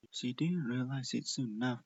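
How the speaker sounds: usual speaking voice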